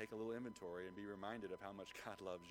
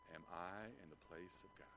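soft quoted question; 3 semitones lower, 8 dB quieter than usual. Shown as a demonstration. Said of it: "Think about that for a second".

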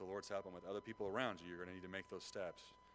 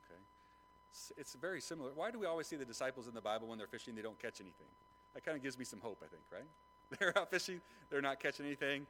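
ordinary delivery

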